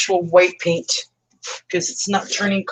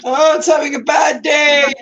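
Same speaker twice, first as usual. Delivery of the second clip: mock wail; 9 semitones higher, 5 dB louder than usual. Mock wail over the paint.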